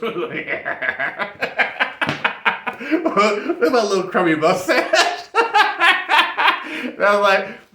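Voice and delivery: giggling through it; laughing at his own story. Real, not acted. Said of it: "I'm doing a little laughing".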